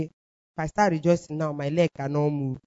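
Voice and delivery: low, soft self-talk; talking to oneself. Retold or imitated. Woman reasoning with herself in her room, low and soft. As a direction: mull it over privately, low and soft. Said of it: "And I asked myself".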